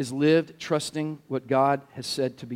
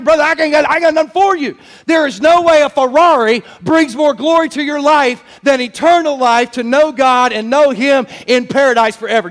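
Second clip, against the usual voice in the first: loud, raised, driving sermon delivery; full-force preaching; 9 semitones higher, 6 dB louder than usual. Real, not acted. Preacher exhorting the congregation about salvation, full-force preaching. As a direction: preach it hard with punched key words.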